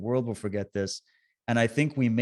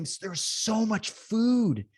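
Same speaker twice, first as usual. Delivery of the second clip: amazed; 8 semitones higher, slower than usual. Real, not acted. Marvelling at the truckers' food.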